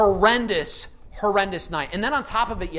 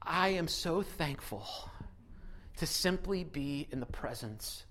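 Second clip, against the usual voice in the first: soft, slow, hushed thankfulness; tender awe; 3 semitones lower, 12 dB quieter than usual. Retold or imitated. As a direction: breathe it out in quiet wonder. Tender remembered thought at baby.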